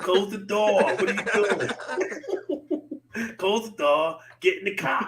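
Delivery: laughing, high mock Boston voice; Boston accent. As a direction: ham up a Boston local. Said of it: "Love you, Boston".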